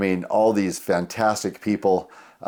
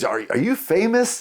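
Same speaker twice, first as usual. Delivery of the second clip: high-pitched, stammering imitation of an onlooker; retold question in a raised, wondering voice; curious stranger; 11 semitones higher than usual. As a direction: play a starstruck stranger asking. Retold stranger recognizing him.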